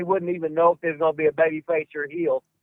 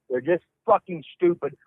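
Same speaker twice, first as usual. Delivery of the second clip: usual speaking voice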